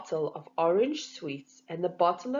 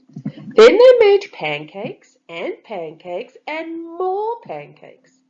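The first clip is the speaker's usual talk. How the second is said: slow, loud savouring of each repeat; drawn-out relish; 7 semitones higher, 13 dB louder, slower than usual. A narrator's reading. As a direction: stretch out the pile of pancakes. Narrator relishes abundance.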